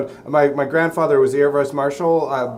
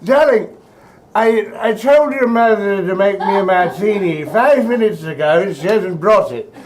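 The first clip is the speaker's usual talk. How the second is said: hoity-toity grandma; 2 semitones higher than usual. Retold or imitated. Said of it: "kind of a hoity-toity".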